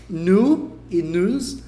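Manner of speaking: 'nu e news' with a pure 'oo', no glide; exaggerated wrong 'nu'. Shown as a demonstration. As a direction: draw out 'nu e news' as a plain noo, nooz. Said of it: "a pronúncia delas não é nem".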